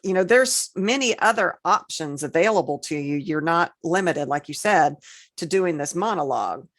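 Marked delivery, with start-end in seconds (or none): none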